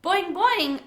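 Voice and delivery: high, loud springy boing; twanging frog. A narrator's reading. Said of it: "Og twanged".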